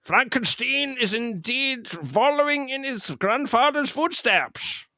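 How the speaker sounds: put-on high, stiff German-style accent; Inspector Kemp's accent. Fan imitating Inspector Kemp's German accent. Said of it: "Inspector Kemp with his great accent".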